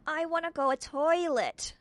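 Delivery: put-on high, loud, slow child's wail; screaming toddler. Little brother Wentworth screaming at Tiffany to go to the toilet. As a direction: whine it like a desperate little boy. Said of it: "screamed Wentworth".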